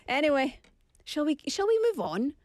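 ordinary talk

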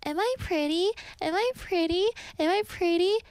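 high, coaxing copycat voice calling out; mimic monster's lure. Mimic tiger calling to hikers on the mountain, high sweet lure. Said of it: "And you would go".

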